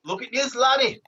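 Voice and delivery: high, hammed-up Scottish accent; excitable mock Scotsman. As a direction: pitch it up in broad Scots. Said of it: "A little bit of Scotland".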